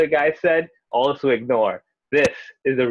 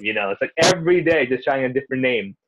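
normal talking voice

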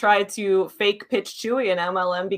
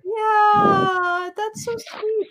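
touched; 10 semitones higher, much slower than usual. Real, not acted. Touched by story.

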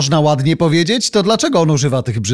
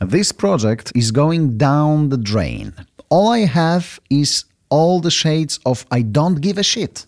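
slow careful repetition; slower than usual. Shown as a demonstration.

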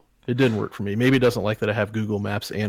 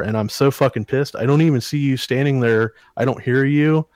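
ordinary delivery

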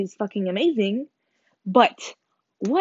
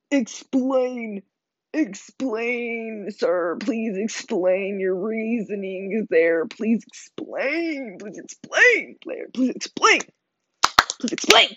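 exasperated mock pleading; much slower than usual. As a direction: plead theatrically for an answer. Exaggerated demand for an explanation.

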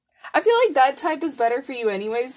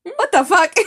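high, loud baffled outburst; astonished what the fuck; incredulous; 7 semitones higher, 6 dB louder than usual. Real, not acted. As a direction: throw it out in disbelief. Reacting to color theory.

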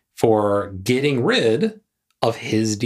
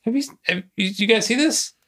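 excited discoverer; 11 semitones higher than usual. Retold or imitated. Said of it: "being like".

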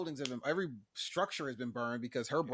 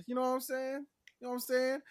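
pitched way up, teasing; high playful voice; 11 semitones higher than usual. Retold or imitated. Joking with friends in a high squeak. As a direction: squeak it up like a joke.

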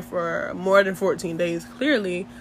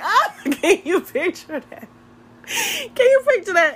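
high, squealing through laughter; real cracking up; 11 semitones higher than usual. Real, not acted. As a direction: ask it helplessly laughing, pitch leaping. Cracks up at own pretend dispatcher.

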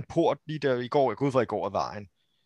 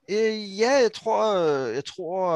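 lightly voiced town guard, higher and unsure; hesitant guard; 6 semitones higher, 4 dB louder, much slower than usual. Guard answers travellers asking where he is, hesitant guard. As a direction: think aloud at a raised pitch.

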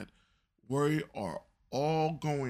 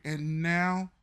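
normal talking voice

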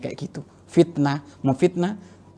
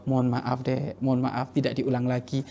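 softer, soothing husband's apology; gentle apology; 3 semitones lower, 8 dB quieter than usual. Retold or imitated. Husband apologising to his angry wife, softer. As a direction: soothe her softly. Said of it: "suami yang jawab".

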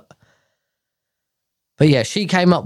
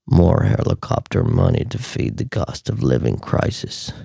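much lower, half-whispered grave read; deep whispery tweet voice; 7 semitones lower than usual. Shown as a demonstration. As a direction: read it like a hushed deep warning. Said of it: "I can't read one like this".

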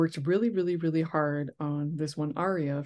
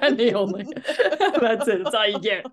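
higher and louder, laughing through the words; laughing echo; 5 semitones higher, 6 dB louder than usual. Real, not acted. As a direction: laugh along as you repeat it.

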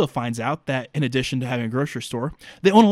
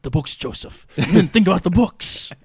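lightly voiced old bookseller, sterner and louder at the end; 4 semitones higher, 5 dB louder than usual. Bookseller scolds caged Joe over the books, lightly voiced. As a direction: scold him about the books like an old mentor.

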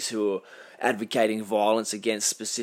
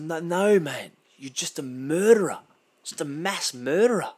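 sharply higher pitched, indignant retort; outraged rebuttal; 6 semitones higher than usual. Real, not acted. Dismissing the attacker's political claims, higher and indignant. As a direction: shoot down his grand claims.

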